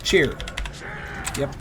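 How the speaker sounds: ordinary talk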